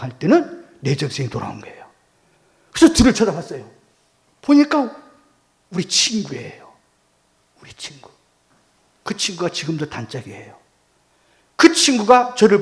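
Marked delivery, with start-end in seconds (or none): none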